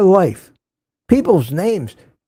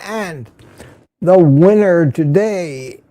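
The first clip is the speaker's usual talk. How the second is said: announcer reveal; 7 semitones higher, much slower than usual. Acted. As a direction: build the winner up like a game show host. Daily first-comer winner announcement.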